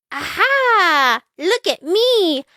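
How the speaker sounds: high, bright playful cat voice; excited cry. A narrator's reading. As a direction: show off the costume with glee. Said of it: "cried Splat".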